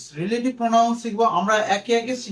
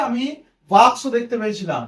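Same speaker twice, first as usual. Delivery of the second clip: ordinary talk, nothing marked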